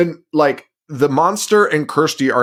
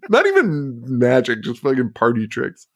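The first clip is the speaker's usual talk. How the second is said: normal talking voice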